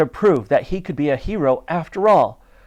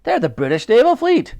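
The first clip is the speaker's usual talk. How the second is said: loud, high officer's voice; commanding Admiral; 11 semitones higher, 5 dB louder than usual. A narrator's reading. The Admiral warns about the fleet, commanding and loud. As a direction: declare it to the crew. Admiral addresses the crew proudly.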